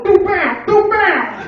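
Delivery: high, loud mangled words; garbled punchline voice. Acted. Woman answers the fishmonger in loud garbled speech. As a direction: push the garbled words out loudly.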